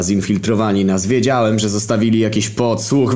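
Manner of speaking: plain reading voice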